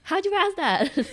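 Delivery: high, loud, drawn-out disbelief; mock incredulous question. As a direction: ask slowly, as if utterly baffled. Acting out jealous reaction to friends.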